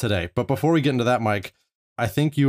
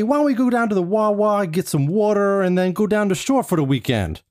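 high, hammed-up Philadelphia accent; terrible Philadelphia accent; 10 semitones higher than usual. Host jokingly inviting his co-host down the shore, in a hammed-up high Philadelphia accent. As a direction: play a Philly local badly, pitched up high. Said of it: "my terrible impression of a Philadelphia accent".

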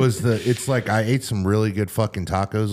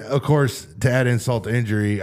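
ordinary delivery

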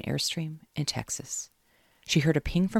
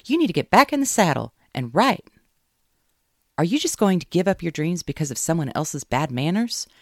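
loud, high pep-talk push; rally her, hit it hard; rousing; 5 semitones higher, 8 dB louder than usual. Acted. Caroline pushing Kate to write again, loud and high.

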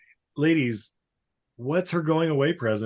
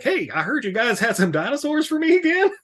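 put-on old man voice; 9 semitones higher than usual. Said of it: "is like".

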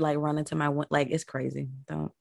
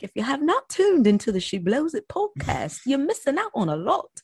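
higher, announcer-style plug; promo skit voice; 9 semitones higher than usual. Retold or imitated. Host replaying her skit plugging her podcast, higher announcer style. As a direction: sell the podcast like an ad. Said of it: "skit where I was just like".

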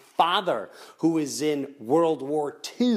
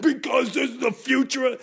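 mock alarm; 10 semitones higher, 3 dB louder than usual. Imitating an agitated worrier.

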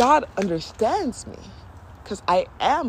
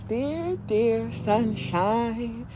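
slow, drawn-out tender cooing; gently put-on consoling voice; 3 semitones higher, much slower than usual. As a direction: comfort a crying child, very slowly. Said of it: "My higher self was like".

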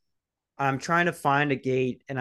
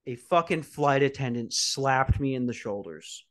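usual speaking voice

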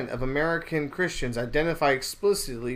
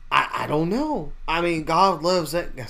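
Joel Osteen impression; 2 semitones higher than usual. Joel Osteen dodging Larry King's question, hesitant mock voice. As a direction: imitate a vague televangelist dodging the question. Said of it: "he has that, like, accent".

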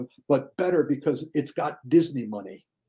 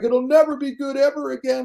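high whiny mock lament; mock despair; 11 semitones higher, 4 dB louder than usual. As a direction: wail it like the world ended. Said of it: "It's like".

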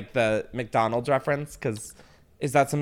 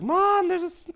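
calling out. Retold or imitated. Child calling to mother.

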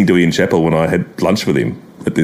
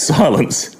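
usual speaking voice

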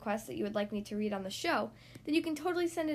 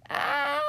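long, loud cartoon cry; short theatrical scream of alarm; mock scream; 6 dB louder than usual. Animals swept away in the crash, screaming. Everyone falls as house collapses.

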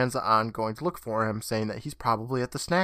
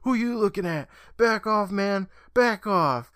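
much higher hotheaded yell; hotheaded shouting; 8 semitones higher than usual. Retold or imitated. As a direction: snap back at him, high and heated. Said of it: "who yells".

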